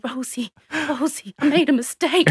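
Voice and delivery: small voice rising into confession; mock-petrified little-kid plea; petrified child. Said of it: "and she was petrified".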